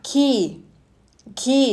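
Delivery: "key" for quay, said like a door key; correct pronunciation. Shown as a demonstration. Teacher revealing the word is unlike its spelling, correct "key". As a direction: say "key" with a plain k and long ee. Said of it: "ออกเสียงไม่เหมือนตัวสะกดเลย".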